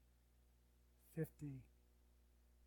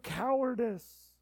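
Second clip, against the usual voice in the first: drawn-out emphasis; 7 semitones higher, 14 dB louder, much slower than usual. Acted. Slowed emphatic word.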